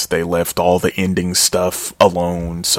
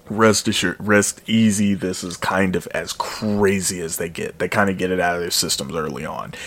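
usual speaking voice

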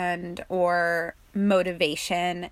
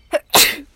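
loud sharp real cough; sudden cough; 8 semitones higher, 10 dB louder than usual. Real, not acted.

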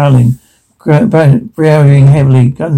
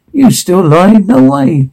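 pitched high in happy disbelief; high, incredulous relief at finding them alive; can't believe they survived, voice way up high; amazed disbelief; 8 semitones higher than usual. Acted. Finding the companion still alive.

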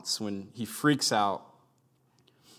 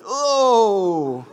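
high loud gasped oh; sudden startled cry; shocked oh; 10 semitones higher, 12 dB louder than usual. Retold or imitated. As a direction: blurt it out in shock. Stunned reaction to explosion.

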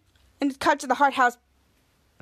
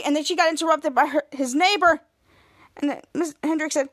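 ordinary talk

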